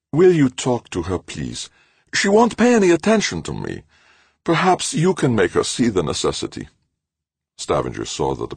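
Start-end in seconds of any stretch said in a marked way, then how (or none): 0.1-6.7 high, begging professor voice; pleading. A narrator's reading. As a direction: plead with him, near wits' end. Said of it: "Ulrich pleaded".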